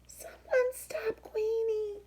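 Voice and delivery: high, voiceless whisper of a cry. Acted. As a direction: call for help but barely make a sound. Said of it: "Quincy's voice is too quiet".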